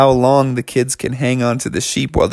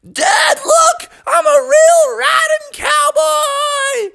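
much higher, slow boastful shout; excited kid; much slower than usual. Acted. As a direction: show your dad you did it. Bubby shows off riding.

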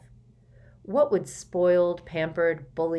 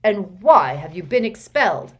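loud, hard interrogation; furious uncle cross-examining; angry demand; 2 semitones higher, 11 dB louder than usual. Acted. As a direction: bark the question at the boy.

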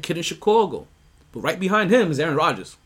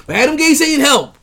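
much higher and louder burst; emphatic outburst; 11 semitones higher, 9 dB louder than usual. Real, not acted. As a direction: blurt it out in real exasperation. Venting about the Adam Gase era.

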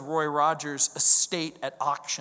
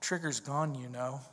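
sad and quiet; 6 semitones lower, 11 dB quieter than usual. Retold or imitated. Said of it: "he looked up at me again and he said".

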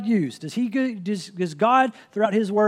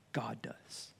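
whisper; 7 semitones lower, 20 dB quieter than usual. Real, not acted. Preacher's dramatic answer.

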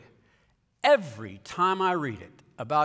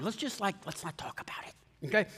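near-whispered mock plea; soft, sheepish aside, imitating a beggar for mercy; hushed plea; 3 semitones higher, 8 dB quieter than usual. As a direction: drop to a conspiratorial hush. Shimei's plea paraphrased as hushed aside.

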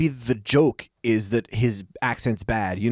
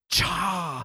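higher, softer drawn-out catchphrase; Carry On throwback; 4 semitones higher, 5 dB quieter than usual. Retold or imitated. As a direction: mimic a campy old-comedy exclamation. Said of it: "His little Carry On throwback bit".

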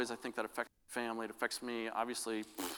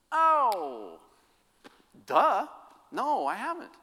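higher, louder self-mocking oh duh; slap-your-forehead moment; sudden realization; 11 semitones higher, 9 dB louder, much slower than usual. Pastor retelling his own reaction to his wife, sheepish.

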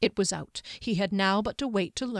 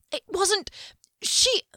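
high, louder wailing blubber; 13 semitones higher, 5 dB louder than usual. A narrator's reading. Servant blubbering excuses to his master, high and wailing. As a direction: let it break into a blubbering wail. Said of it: "he blubbered".

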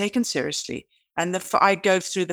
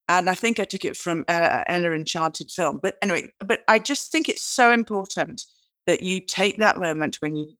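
ordinary talk, nothing marked

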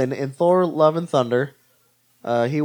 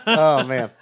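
ordinary talk, nothing marked

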